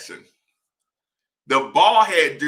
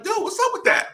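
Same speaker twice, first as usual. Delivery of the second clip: incredulous complaint; 3 dB quieter than usual. Retold or imitated. Confronting teammate.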